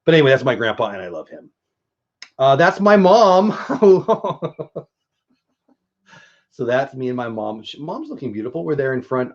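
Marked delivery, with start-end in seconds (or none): 3.5-4.8 amused laugh. Real, not acted. Laughing at photo of mom.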